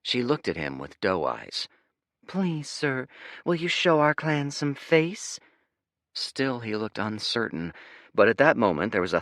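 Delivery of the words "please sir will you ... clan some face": high, sweet, begging; pleading fake voice. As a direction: make the eyes big and plead. Spy Girl pleading fake voice.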